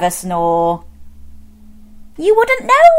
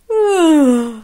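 long weary bear sigh, higher and louder; tired sigh; 6 semitones higher, 3 dB louder than usual. Acted. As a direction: let out a big sleepy sigh.

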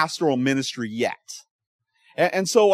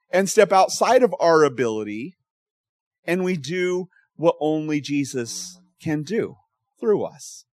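ordinary talk, nothing marked

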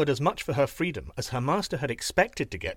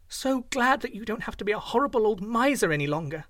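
put-on higher girlish delight; glad girl; 6 semitones higher than usual. A narrator's reading. Hugh Lin rejoices for the young man, higher girlish delight. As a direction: keep her happy lilt. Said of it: "said Hugh Lin".

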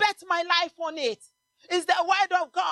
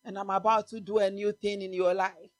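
building sermon; 4 semitones lower than usual. Acted. Preaching builds over music.

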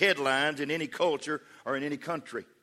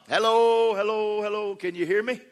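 calling out; 6 semitones higher, 3 dB louder than usual. Acted. Believer calling to a silent God, calling out. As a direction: call into the distance, checking for an answer.

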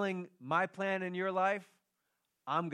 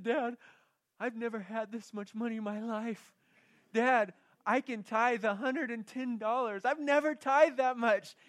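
high, slow, swelling excitement, half crying; excited daughter; 8 semitones higher, 4 dB louder than usual. Retold or imitated. Pastor voicing his daughter's joy over the gift, excited. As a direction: imitate the daughter overjoyed, building louder. Daughter's joyful call retold.

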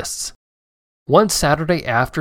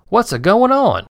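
higher, louder country farmer exclaiming; surprised farmer shouting into the maze; folksy farmer; 9 semitones higher, 5 dB louder than usual. Acted. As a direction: call it out like a puzzled old farmer. Farmer voiced in story.

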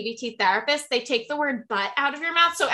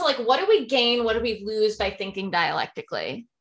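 ordinary talk

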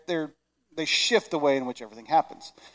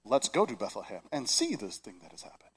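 lower, softer, solemn and stiff; overly reverent; 7 semitones lower, 9 dB quieter than usual. Shown as a demonstration. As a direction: recite it like a dry, stuffy reverence. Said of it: "we treat it with such kid gloves".

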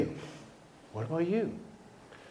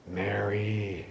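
a soft, lower, quiet calling of her name; tender familiarity; 7 semitones lower, 12 dB quieter than usual. Acted. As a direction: say her name gently, like an old friend. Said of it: "Must have said it in a certain way, you know, just that familiarity".

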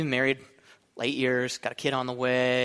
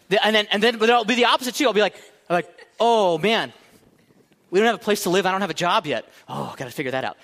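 mock panic; 4 semitones higher, 5 dB louder than usual.

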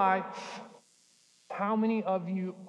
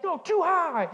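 put-on child's insistent protest; 9 semitones higher, 4 dB louder, slower than usual. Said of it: "she's like".

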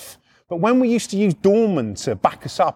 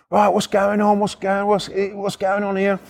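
lightly voiced copper, level monotone questioning; slightly higher, measured "what's going on here"; policeman arriving; 4 semitones higher than usual. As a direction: play the officer taking charge.

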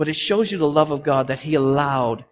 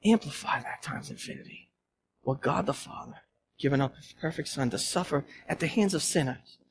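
near-whispered, much softer; hushed awe over the Father's sacrifice; 3 semitones lower, 12 dB quieter than usual. Real, not acted. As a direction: drop to a hush for the gravity of it. Preacher drops voice for weight.

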